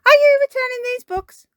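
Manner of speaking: very high, loud cartoon librarian; Miss Rabbit. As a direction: squeal the question across the counter. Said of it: "she says".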